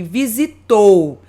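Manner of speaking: "visitou" slow, the final "ou" pushed out; stress on ou. Shown as a demonstration. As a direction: stretch "visitou" and lean on the "ou". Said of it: "essa vogal, o ou ... mais forte". ("visitou" devagar, com o "ou" mais forte)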